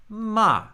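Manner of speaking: Ma starts very high and falls at once; fourth tone. Shown as a demonstration. Teacher shows the fourth tone falling from first-tone height. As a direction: drop Ma the moment it starts. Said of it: "begin my fourth tone on that same pitch".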